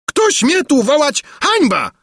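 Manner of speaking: very high, outraged challenge; furious cry. A narrator's reading. King Padella challenging the jeering crowd, furious cry. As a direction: cry out, seething with rage. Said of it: "wykrzyknął Padella, drżący z wściekłości".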